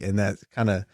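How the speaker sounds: ordinary delivery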